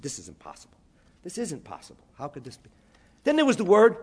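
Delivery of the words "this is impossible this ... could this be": awed hush. Retold or imitated. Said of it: "I said".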